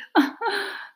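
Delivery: high mocking laugh; beast laughs. A narrator's reading. Beast scoffing at the girl with a laugh. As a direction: laugh at the threat. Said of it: "the beast laughed".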